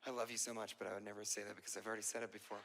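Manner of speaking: mock tender confession. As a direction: whisper it like a sappy confession. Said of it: "come to her and go".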